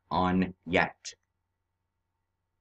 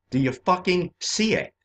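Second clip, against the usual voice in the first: higher, pushed-up demand with stress on see; insistent, raised, with see punched; forceful repeat; 7 semitones higher than usual. Real, not acted. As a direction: hammer the question home. Repeats the question, second more forceful.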